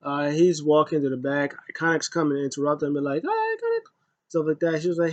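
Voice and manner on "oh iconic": put-on very high, sing-song girly squeal. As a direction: squeal it like a mocked teen idol. Iconics interrupt, voiced mockingly.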